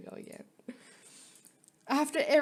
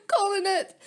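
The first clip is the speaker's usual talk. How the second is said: laughing; 10 semitones higher, 5 dB louder than usual. Real, not acted. Laughing prediction.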